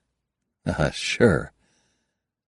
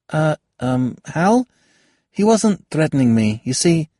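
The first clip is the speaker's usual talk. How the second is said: higher voiced young woman, pressing her point; young woman arguing; 9 semitones higher, 5 dB louder than usual. Kayada lectures Halbert on the nobles, higher and firmer. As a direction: lay out the case sharply, rising as she goes. Kayada timidly placates Hal.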